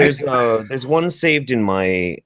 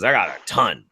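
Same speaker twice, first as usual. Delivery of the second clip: normal talking voice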